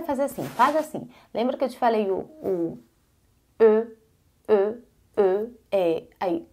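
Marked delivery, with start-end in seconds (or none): none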